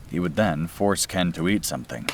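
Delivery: usual speaking voice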